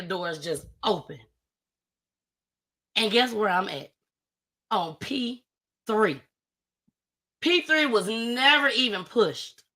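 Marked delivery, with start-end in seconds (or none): none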